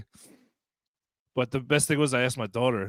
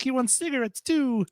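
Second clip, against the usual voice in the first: mock kid tattling; 12 semitones higher, slower than usual. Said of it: "it'd be funny to be like".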